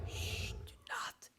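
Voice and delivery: breathless hushed whisper; whispering over a crib. Acted. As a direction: whisper so nobody wakes. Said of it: "Don't wake him up, chat. Be quiet".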